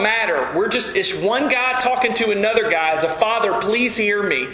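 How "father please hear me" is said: ordinary delivery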